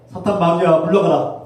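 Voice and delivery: shouted rebuke for the church to repeat; loud command. Shown as a demonstration. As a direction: bark it out for them to echo. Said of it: "따라하십시오". (따라하라고 외치는 큰 명령)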